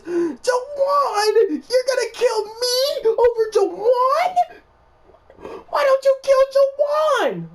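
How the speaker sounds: high, loud, slow wailing plea; sobbing yell, high and drawn out; panicked crying shout. Retold or imitated. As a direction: cry it out in terror, voice way up. Begging for life in retold dream.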